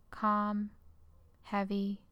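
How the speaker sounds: normal talking voice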